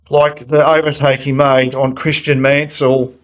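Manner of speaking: ordinary delivery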